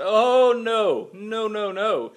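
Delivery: loud, high string of refusals; hammed-up indignant dad; emphatic mock refusal. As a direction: shut a child down with exaggerated force. Dad refusing son's request for bed.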